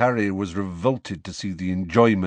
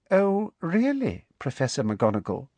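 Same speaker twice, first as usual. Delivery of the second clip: deadly voice; 8 semitones higher than usual. Acted. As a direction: say it sweetly as a threat. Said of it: "said Umbridge in a deadly voice".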